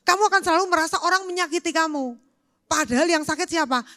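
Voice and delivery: ordinary talk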